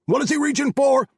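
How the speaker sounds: high, loud alarmed shout. A narrator's reading. Carl seeing Hamid reach into his robe, panicked shout. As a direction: yell it in panic. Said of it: "Carl shouted".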